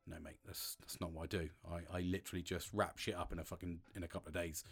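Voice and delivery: telling a client the job is done in two days, softly; quiet retold reply. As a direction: brush it off quietly, almost under the breath.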